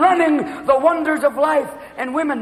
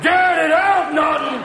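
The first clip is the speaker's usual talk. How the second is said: Ralph Kramden bellow; 6 semitones higher, much slower than usual. Retold or imitated. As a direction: bellow like a sitcom husband. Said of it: "transforming into Ralph fucking Cramden".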